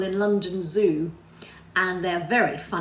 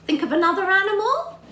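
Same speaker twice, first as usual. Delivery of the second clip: bright prompt; 12 semitones higher, 7 dB louder, faster than usual. Acted. Excited prompt to children.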